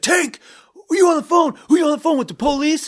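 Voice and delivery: very high, very loud paranoid yelling; furious shout. Retold or imitated. Roommate in his underwear yelling at Tank, furious and paranoid. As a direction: scream it like a paranoid man accusing his roommate. Roommate Greg enraged, on crack.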